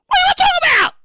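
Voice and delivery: high, loud incredulous yell; outraged shout. Pretend. Host self shouting at writer alter ego. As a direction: shriek in disbelief. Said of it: "the two alter egos".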